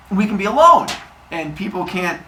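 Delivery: halting, with loud high bursts; acted cover story, punched after pauses; Zeus. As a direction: invent the excuse bit by bit. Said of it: "He goes".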